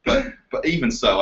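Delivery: ordinary talk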